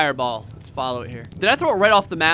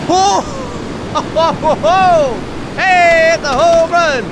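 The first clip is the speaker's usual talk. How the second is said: loud, high home-run call; big laughing "ho ho" cheer, then a shout; excited whooping; 10 semitones higher, 7 dB louder, much slower than usual. Real, not acted. Tornado flings things, player cheers.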